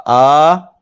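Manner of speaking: loud, high plain open "A"; wrong reply sound. Shown as a demonstration. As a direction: mistaken "A" called back loudly. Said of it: "Ada tak kita jawab".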